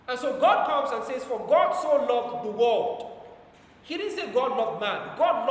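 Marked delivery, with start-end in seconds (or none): 1.2-3.2 slow, loud, weighty reading of the verse; slow scripture quote. Acted. Preacher to the congregation, slow and loud quoting the verse. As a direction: lay each word down like scripture.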